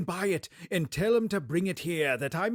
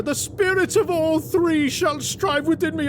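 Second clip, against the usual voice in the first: desperate vow; 10 semitones higher, 6 dB louder than usual. Acted. Scrooge vows to the spirit.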